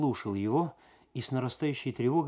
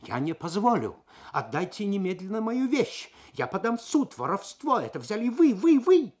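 rising to a much higher, louder furious yell; furious shouting; 10 semitones higher, 7 dB louder than usual. A narrator's reading. Losar yelling at the frightened maid, furious and building. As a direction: build the rage until it cracks on the last вы. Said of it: "яростно кричал".

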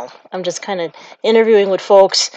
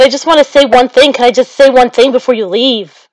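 higher, louder mock plea; mild pleading; 4 semitones higher, 6 dB louder than usual. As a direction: lightly act out begging for one last word. Acts out pleading with Jesus.

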